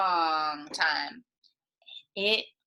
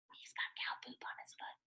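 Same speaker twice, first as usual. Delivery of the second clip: whisper; 22 dB quieter, faster than usual. Acted. Giggly whispered aside to children.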